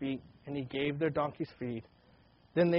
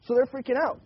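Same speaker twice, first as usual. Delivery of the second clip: excited; 8 semitones higher, 6 dB louder than usual. Real, not acted. Preacher stressing the brothers' panic.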